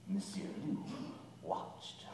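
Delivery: low, hushed, menacing narration; suspenseful whisper. A narrator's reading. Suspense as wolf watches.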